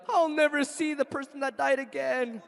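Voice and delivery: high-pitched put-on wail of despair; mock lament. Voices a superstitious fear of death.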